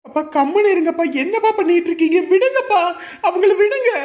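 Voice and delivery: very high, loud cry to stop; frantic plea. Acted. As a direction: shout in alarm to pull father off. Begging father to let go. (பதறிக் கத்திக் கெஞ்சும் குரல்)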